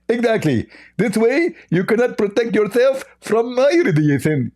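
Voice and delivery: high, lisping, exaggerated caricature; Zakir Naik. Mock preacher warning the host of radiation, high and lisping. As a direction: explain grandly in a high lispy voice. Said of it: "Hey, Zakir Naik".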